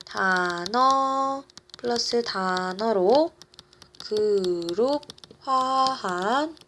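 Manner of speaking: words drawn out while writing; halting, slow write-along pace; slow dictation. Real, not acted. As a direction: stretch each syllable for note-takers. Spells out notes while writing.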